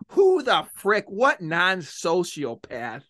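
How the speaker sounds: ordinary delivery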